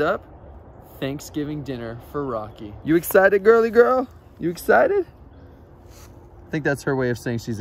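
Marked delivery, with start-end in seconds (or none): 2.8-5.1 loud, high, bubbly cheer; excited pet talk. Real, not acted. Owner hyping his possum for dinner, loud excited baby talk. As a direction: pump her up like a hype man.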